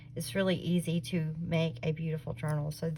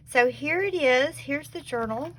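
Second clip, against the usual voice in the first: excited reveal; 6 semitones higher, 5 dB louder than usual. Real, not acted. Unveiling the journal to viewers.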